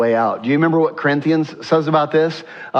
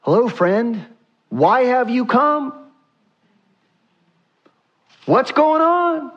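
higher, slower, rising to a high plea; gentle questioning Jesus; 9 semitones higher than usual. Acted. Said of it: "Just like this".

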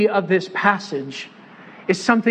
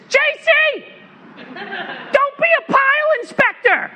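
much higher, put-on coach impression; coach's voice. Linebacker coach scolding young player, high mock voice. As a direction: imitate the old coach barking at his player. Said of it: "he actually talked like this".

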